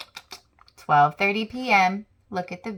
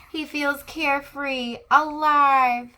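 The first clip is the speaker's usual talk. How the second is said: joyful; 7 semitones higher than usual. Acted. Squirrel gliding carefree, joyful outburst. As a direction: let it burst out with joy.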